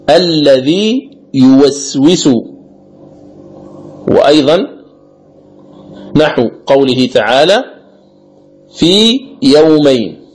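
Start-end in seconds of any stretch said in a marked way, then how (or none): 0.1-2.3 الذي يوسوس slowly, the long ī ending الذي held clear of the next ya; madd al-tamkin. Shown as a demonstration. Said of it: "الياء الأولى المدية". 8.8-10.2 في يومين slowly, the ī of في held before a separate ya; madd al-tamkin. Shown as a demonstration. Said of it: "بمقدار حركتين".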